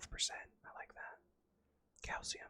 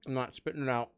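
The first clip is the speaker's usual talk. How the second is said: loud; 2 semitones lower, 16 dB louder, faster than usual. Real, not acted. Refuses to spit out treat.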